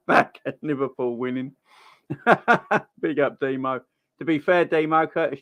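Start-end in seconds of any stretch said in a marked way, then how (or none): 2.1-2.9 burst of laughter. Real, not acted. Laughs at viewer's Curtis Jones joke.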